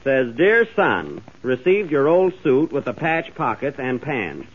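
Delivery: ordinary talk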